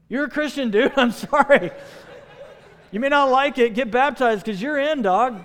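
high, loud, playful insistence; emphatic exclamation. Retold or imitated. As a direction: blurt it out to a hesitant buddy, loud and high. Mock-exasperated verdict to hesitant friend.

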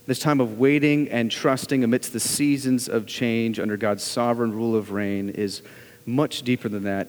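ordinary delivery

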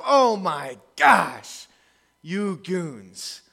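very slow, drawn-out fond groan; affectionate head slap. Retold or imitated. Jesus to his clueless disciples, fond slow exasperation. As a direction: sigh at them like lovable fools. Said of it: "affectionate head slap".

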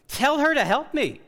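high, loud demanding order; commanding. Acted. Martha ordering Jesus to make her sister help, high and loud. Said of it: "I don't know that it came in that tone".

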